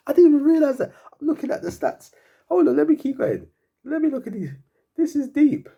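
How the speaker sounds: high, loud, half-crying laughter; laughing so hard it nearly breaks into tears; laughing disbelief. Real, not acted. Fan reading his team's stats, laughing in helpless disbelief.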